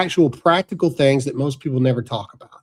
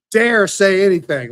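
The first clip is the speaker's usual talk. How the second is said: normal talking voice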